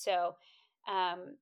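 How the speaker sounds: ordinary delivery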